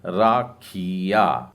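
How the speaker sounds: राखिया said very slowly, each syllable clear; राखिया stretched out; slow correct pronunciation. Shown as a demonstration. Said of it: "ताकि आप सही उच्चारण कर लें". (सही उच्चारण राखिया, बहुत धीरे)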